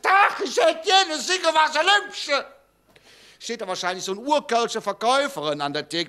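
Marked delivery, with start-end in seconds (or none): 0.0-2.5 shouted order. Pretend.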